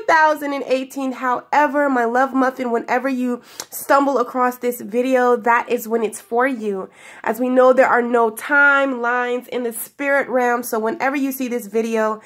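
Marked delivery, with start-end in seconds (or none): none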